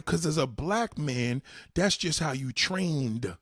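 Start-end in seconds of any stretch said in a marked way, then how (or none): none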